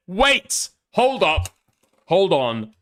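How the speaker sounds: loud, high-pitched double take; stunned interjection. Real, not acted. Stunned reaction to the message.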